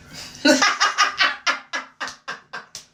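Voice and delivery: long cackling laugh, fading out. Real, not acted. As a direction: crack up, unable to go on. Cracks up at his reaction.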